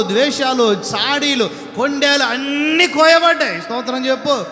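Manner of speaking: full-force preaching. Acted. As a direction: hammer each sin, then cry out. Preaching list up to a shout.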